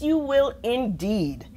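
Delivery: plain reading voice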